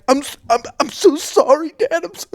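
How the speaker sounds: put-on overwhelmed girl. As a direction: play a breathless eleven-year-old, overwhelmed. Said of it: "and she's like".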